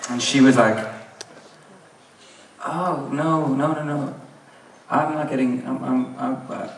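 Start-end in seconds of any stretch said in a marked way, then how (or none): 2.6-6.7 soft, slightly higher, stammering let-down; hesitant quiet brush-off, trailing into stammers; awkward refusal. Retold or imitated. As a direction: imitate her backing away gently. Woman awkwardly declines his hint.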